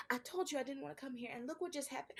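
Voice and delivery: very soft, stunned murmur; barely audible in shock; hushed despair. Acted. Very quiet after assault.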